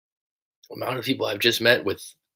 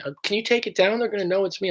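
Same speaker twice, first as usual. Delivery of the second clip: high, anxious plea to take the video down; worried client; 10 semitones higher, faster than usual. Retold or imitated. Worried client begging the coach to pull his story, high and anxious. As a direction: squeak it out like a nervous client begging. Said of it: "sometimes they contact me".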